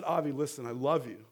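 low, soft fatherly voice; tender reassurance. Retold or imitated. Father consoles his crying six-year-old, tender and reassuring. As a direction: gently settle an upset child.

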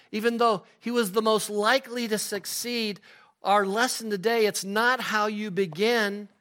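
high, driving preaching voice; emphatic preaching. Real, not acted. Preacher giving today's lesson, emphatic preaching.

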